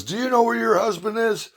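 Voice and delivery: high, pointed imitation of a teen daughter; mock high accusing teen voice. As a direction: pitch up and pry like a nosy kid. Daughter voiced calling Amy.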